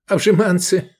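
playful teasing. A narrator's reading. Drunk pal caps his rhyming joke, playfully teasing. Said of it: "игриво удивился он".

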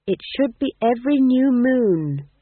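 high, loud, slow insistence; slow stern reproach in a high voice. A narrator's reading. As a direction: lay down the rule sternly, pitched high. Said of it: "said Magrat sternly".

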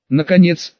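usual speaking voice

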